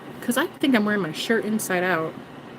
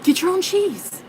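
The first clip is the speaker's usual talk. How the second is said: mock scolding; 10 semitones higher, slower than usual. Telling mouse off.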